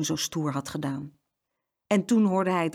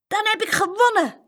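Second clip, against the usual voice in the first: put-on high, loud little-boy triumph; happy; 7 dB louder than usual. A narrator's reading. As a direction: crow with delight at winning. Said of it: "zei Jeroen blij".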